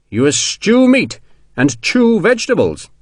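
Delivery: slow, high, relishing the joke; drawn-out, teasing question with high swoops; amused incredulity. A narrator's reading. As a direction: repeat it slowly in delighted disbelief. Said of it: "decided this was a splendid joke".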